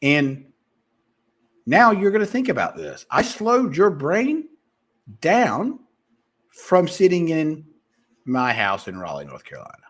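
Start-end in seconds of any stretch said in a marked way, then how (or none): 3.1-9.8 slowed down delivery. Shown as a demonstration. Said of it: "I just slowed your brain down".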